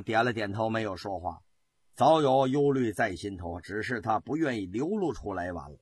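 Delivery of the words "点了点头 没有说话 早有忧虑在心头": ordinary delivery, nothing marked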